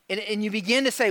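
ordinary delivery

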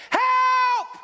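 a full-throated cry for help, high and loud; desperate shout. Retold or imitated. Preacher crying out for help as Paul. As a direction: scream it so the room falls silent. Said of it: "He's not saying, "Help," like your mom".

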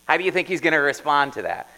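chuckling to the congregation; laughing question. Real, not acted. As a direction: laugh it out, amused.

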